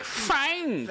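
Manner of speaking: "Thanks." said high as "Fanks" with an F; Cockney thanks. Shown as a demonstration. Comic showing the Cockney F, saying "Thanks." as "Fanks". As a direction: swap the th in "Thanks." for an F. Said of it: "thanks starts with an F".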